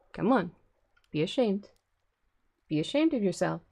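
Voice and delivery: loud, playful telling-off; mock scolding. Real, not acted. Playfully shaming the cat in front of Night Warrior. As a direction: shame the cat in jest.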